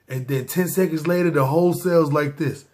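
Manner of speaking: ordinary talk, nothing marked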